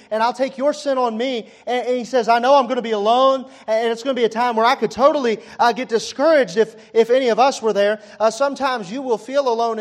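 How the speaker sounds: high, loud impassioned preaching; full-force preaching. Acted.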